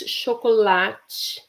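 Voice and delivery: ordinary talk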